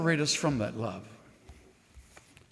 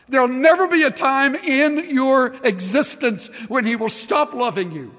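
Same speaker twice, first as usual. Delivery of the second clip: loud, high, rousing preacher's call; fervent altar-call preaching; full-force preaching; 5 semitones higher, 4 dB louder than usual. Acted. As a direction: lift the whole room, preach it out loud.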